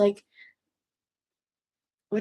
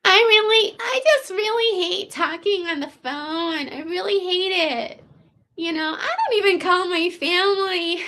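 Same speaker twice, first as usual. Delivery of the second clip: put-on valley girl; 4 semitones higher than usual. Imitating the woman on the phone, a light valley girl voice. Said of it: "super valley girl".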